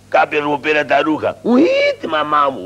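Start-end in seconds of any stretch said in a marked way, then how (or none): 1.4-2.0 very high squeaky yelp; a sudden high puppet shriek; high shrill yelp of fright; startled squeal. Acted.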